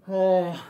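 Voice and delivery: loud tired groan; weary groan. Real, not acted. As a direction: groan at the next round. Dreading next round.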